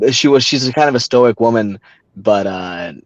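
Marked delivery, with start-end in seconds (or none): none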